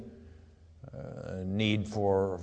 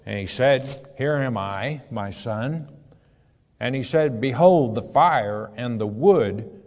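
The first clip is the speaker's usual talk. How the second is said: raised, measured Bible reading voice; scripture reading; 3 semitones higher, 4 dB louder than usual. Acted. Preacher reading Genesis aloud to his flock, solemn reading voice. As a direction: read the verse out to the congregation. Reads Isaac and Abraham dialogue.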